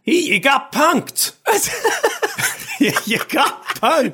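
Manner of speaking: high, drawn-out jeer through laughter; gleeful jeer. Acted. Reader plays Zherkov revealing his prank, laughing high-pitched jeer. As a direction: crow over a successful prank, laughing.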